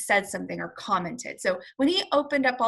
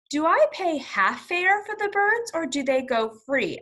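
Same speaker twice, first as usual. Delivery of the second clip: high, lightly voiced Mister Popper asking about the fare; a hint of a polite, puzzled passenger; asking the driver; 7 semitones higher, 5 dB louder than usual. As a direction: pitch the question up, a little put-on. Popper asks the bus driver.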